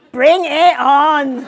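loud, drawn-out rallying cry; tipsy defiance. A narrator's reading. Woman bracing for her father's judgment, tipsy defiance. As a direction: throw down the challenge loudly and slowly. Inebriated defiant thought, read aloud.

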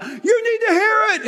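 high, pointing mock-preacher voice; mock preachy voice. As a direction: lecture them in a high, pointed tone. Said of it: "it's not".

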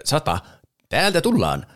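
calling out hide-and-seek. Retold or imitated. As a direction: call out like a cat ending the count. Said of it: "niin se sanoo, että". (matkittu kuuluttava piilosleikin huuto)

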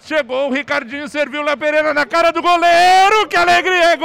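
rising to a shouted goal; building goal call. Real, not acted. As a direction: build fast and explode into the goal. Goal scored, narrator builds. (narração crescendo até o grito de gol)

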